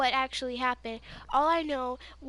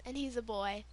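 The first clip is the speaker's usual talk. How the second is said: usual speaking voice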